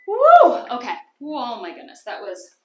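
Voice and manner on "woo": high, loud victory cheer; loud triumphant whoop. Real, not acted. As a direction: shout it out in triumph. Finishing a hard set.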